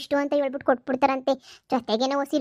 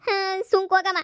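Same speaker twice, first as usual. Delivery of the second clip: tearful daughter sobbing the words out; crying; 3 semitones higher than usual. Acted. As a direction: cry through "ಹೋಗಮ್ಮ" with a catch in the voice. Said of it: "ಅಳ್ತಿರೋದ್ ನೋಡು, ಅಳ್ತಾವಳೆ". (ಅಳುತ್ತಾ ಮಾತು)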